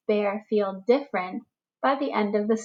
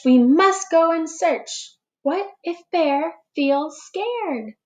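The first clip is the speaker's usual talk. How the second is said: higher, chirpy little bird voice; tweeting bird voice; 7 semitones higher, much slower than usual. Acted. Wren on his perch urging a search, light tweeting voice. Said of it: "Wren tweets".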